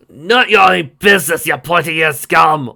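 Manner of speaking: loud slow dwarf yell; angry dwarf shout. Acted. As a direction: bark it at the elf. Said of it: "one of the dwarves exclaimed".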